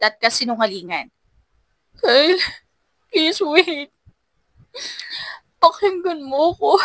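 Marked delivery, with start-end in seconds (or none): 1.9-6.7 high, tearful begging; begging him to listen; desperate pleading. A narrator's reading. She begs him to listen.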